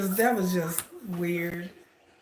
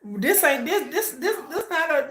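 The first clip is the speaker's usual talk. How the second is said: laughing; 8 semitones higher than usual. Real, not acted. Reacting to TED talk robbery.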